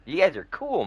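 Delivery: jumps way up high on "guys"; insisting to the banker, high and eager; eager praise. Retold or imitated. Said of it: "I was like".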